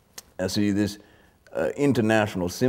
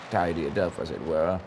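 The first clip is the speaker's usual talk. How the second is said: usual speaking voice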